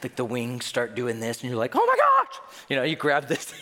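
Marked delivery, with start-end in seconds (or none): 1.7-2.4 very high, loud mock scream; over-the-top turbulence panic; panicked shriek. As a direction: shriek it like a terrified flyer, hammed up. Acts panicked passenger in turbulence.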